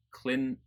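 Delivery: "Clinton" broken as "Clin... n", T held. Shown as a demonstration. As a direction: hold the tongue at T in "Clinton". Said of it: "hold my tongue against the roof".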